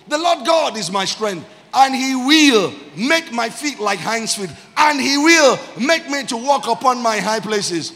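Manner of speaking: loud, high, stretched-out proclamation; shouted group reading. Real, not acted. Preacher leading church reading verse nineteen together, shouted scripture declamation. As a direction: lead the whole room in shouting the verse.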